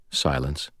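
ordinary delivery, nothing marked

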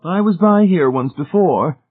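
high, loud lady's voice; Missus Pritchett's prim recollection. A narrator's reading. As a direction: voice a high-pitched, self-assured lady.

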